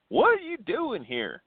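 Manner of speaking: high, slow Tennessee drawl; Tennessee accent. Retold or imitated. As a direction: put on a thick Tennessee accent. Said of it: "I'm trying to do my best Tennessee accent".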